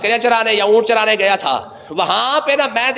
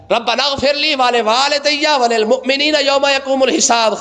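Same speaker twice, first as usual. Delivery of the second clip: slow recitation; 4 semitones higher than usual. Shown as a demonstration. Reciting the full verse slowly.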